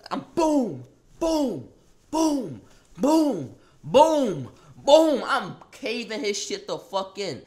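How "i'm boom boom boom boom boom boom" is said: higher, louder boom volley; flurry of booms. Retold or imitated. Raining punches, a rising volley of booms. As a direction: pound out each boom.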